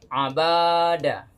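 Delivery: "abada" slow, with the middle syllable "ba" wrongly drawn out long; wrong reading. Shown as a demonstration. Showing students the wrong long "ba" in "abada". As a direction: drag the "ba" in "abada" out on purpose. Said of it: "maka salah bacanya".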